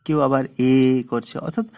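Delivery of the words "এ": "এ" stretched out long; drawn-out vowel. Shown as a demonstration. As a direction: hold "এ" as a prolonged cry. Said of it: "টেনে টেনে উচ্চারণ করা হয়".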